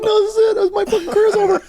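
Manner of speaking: very high, loud, tearful squeal; hammed-up wailing panic in a squeaky voice; freaking out. Actor to wife about his ruined career, squeaky mock panic. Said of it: "like, I freaked out".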